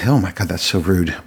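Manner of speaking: ordinary delivery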